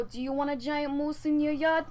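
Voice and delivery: ordinary delivery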